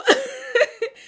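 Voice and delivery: a high, loud burst of laughter. Real, not acted. Laughing as she retells heading out to confront him. Laughing at her own mix-up.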